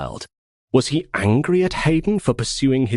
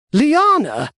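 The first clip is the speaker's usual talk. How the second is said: shocked high louder question; stunned; 5 dB louder than usual. Acted. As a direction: gasp the name in disbelief. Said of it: "Axios gaped".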